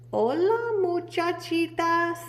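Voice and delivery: high, slow little voice; a sheepish kitten hello; muy soft voice. Acted. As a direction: greet them sweetly and small. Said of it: "in a muy, muy soft voice".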